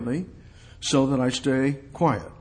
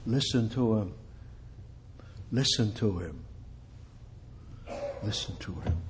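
soft and low, fading with each repeat; hushed urging; 4 semitones lower, 10 dB quieter than usual. Shown as a demonstration. Preacher urging listeners to hear God, fading quieter. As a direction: sink gradually toward a hush.

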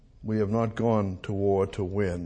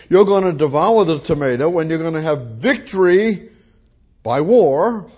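louder, driving pulpit cadence; full-force preaching; 3 semitones higher, 6 dB louder than usual. Acted. Preacher rousing the congregation about war, full-force preaching. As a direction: hammer the war image home.

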